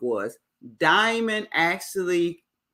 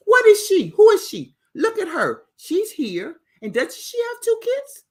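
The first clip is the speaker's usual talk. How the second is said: high, loud indignant questions; mock outrage at the rival; 6 dB louder than usual. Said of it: "And you know [name]".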